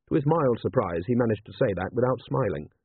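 ordinary delivery

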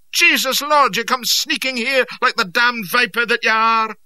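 furious Scots bellow, high and loud; raging shout. Acted. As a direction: roar it in a Scottish accent, spitting rage. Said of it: "scarlet with rage as he shouted".

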